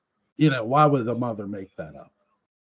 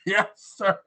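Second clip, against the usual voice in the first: Heston-style voice; 9 semitones higher than usual. Pretend. Co-host hamming up a movie-star reply, lightly. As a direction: answer like a hammed-up screen hero.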